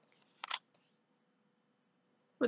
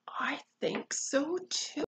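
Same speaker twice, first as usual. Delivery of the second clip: ordinary delivery, nothing marked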